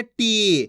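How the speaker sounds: टी drawn out on the vowel; long ee in टी. Shown as a demonstration. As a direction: hold the ई of टी. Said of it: "खींच खींच के पढ़ना है". (टी की ई खींचकर)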